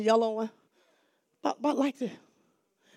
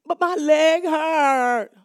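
put-on slow, loud, higher child's whine; whiny child; 5 semitones higher, 11 dB louder, much slower than usual. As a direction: drag it out like a hurt kid. Child whining about his leg.

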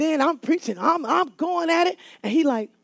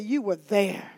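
quiet Jesus voice; 6 semitones lower, 7 dB quieter than usual. Acted. Jesus voiced quietly after shout.

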